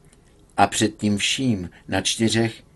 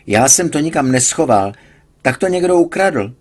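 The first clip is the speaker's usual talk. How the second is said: indignant complaint; 5 semitones higher, 5 dB louder than usual. A narrator's reading. Patient insists on theft.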